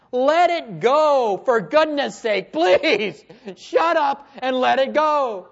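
loud, high, stretched-out begging; exasperated plea. Acted. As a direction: plead at full voice, dragging the words.

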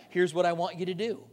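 ordinary delivery

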